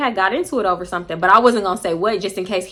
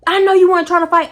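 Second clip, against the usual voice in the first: high loud mock yell; angry man yelling at a woman about fighting; retold yell; 9 semitones higher, 8 dB louder than usual. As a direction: yell it like he's mobbing up.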